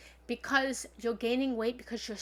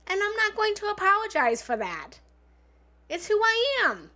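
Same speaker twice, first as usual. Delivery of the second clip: high, loud self-assertion; unapologetic defiance; 9 semitones higher, 6 dB louder than usual. Acted. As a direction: declare it boldly, voice raised. Defiant declaration of self-acceptance.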